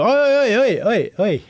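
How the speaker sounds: high, repeated attention-grabbing oi; acted-out customer calling after someone; mock calling out. Calling the owner back in story.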